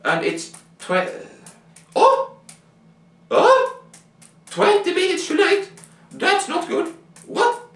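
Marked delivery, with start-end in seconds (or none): 1.9-7.6 high, startled cries of alarm; shocked when told the stone is twenty minutes late, voice jumps high. Pretend. Child panics at lateness news.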